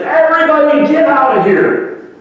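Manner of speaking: high, loud exasperated yell; retold shout. Man in the crowded hut yells at everyone, exasperated shout. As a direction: throw everyone out at the top of your voice. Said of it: "and he shouts".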